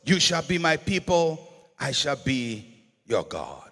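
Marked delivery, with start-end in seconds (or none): none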